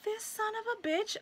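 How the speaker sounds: exasperated disbelief. Retold or imitated. Maintenance man refuses owner contact.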